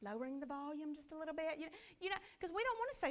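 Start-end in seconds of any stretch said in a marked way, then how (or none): 0.0-1.6 soft, sweet asking instead of snapping; gentle polite request. Retold or imitated. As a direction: ask the kids nicely, softer. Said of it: "So we had to turn around and say".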